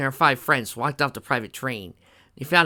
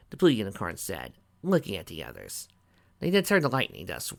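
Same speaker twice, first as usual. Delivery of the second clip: ordinary delivery, nothing marked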